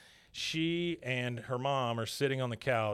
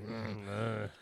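creepy throaty "mm"; creepy grunt of relish; Carl; 3 semitones lower, 7 dB quieter than usual. Retold or imitated. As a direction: hum it like a leering oddball. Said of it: "Reminds me of Carl from, uh, Sling Blade".

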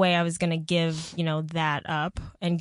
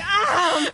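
mock pained yell; 12 semitones higher, 5 dB louder than usual. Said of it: "Would you be like".

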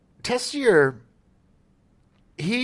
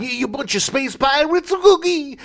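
high, loud, slow hammed-up Brooklyn accent; 4 dB louder than usual. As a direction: ham up a Brooklyn space villain. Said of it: "very thick Brooklyn accent".

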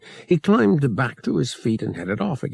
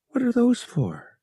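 much higher surprised question. A narrator's reading. Bria seeing the pile of blasters, surprised. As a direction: ask it startled.

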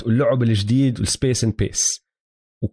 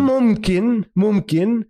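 موافقة متحمسة; 7 semitones higher, much slower than usual. Real, not acted. Agreeing eagerly with co-host.

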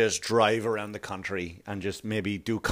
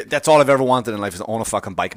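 usual speaking voice

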